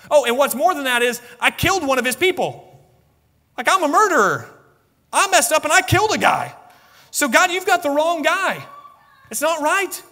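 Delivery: very high, agitated self-accusing excuses; protesting Moses. Acted. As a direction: argue your way out at a high pitch. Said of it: "for Moses to look at him and say".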